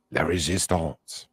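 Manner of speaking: exaggerated Americanized French accent; slow hammy French accent. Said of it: "Americanized French accent".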